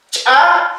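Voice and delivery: "cha" with a sharp popped ch; reinforced ejective consonant. Shown as a demonstration. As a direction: pop the ch, then release the "a" of "cha". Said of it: "Consonantes reforzadas". (consonante reforzada, "cha" con golpe glotal)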